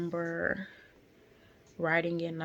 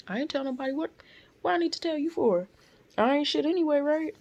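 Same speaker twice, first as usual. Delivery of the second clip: much higher and louder, fast bitter outburst; outraged venting; 9 semitones higher, 6 dB louder, faster than usual. Real, not acted. Nurse ranting to the viewer about being blamed, loud and bitter. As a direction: let the hurt boil over into a loud rant.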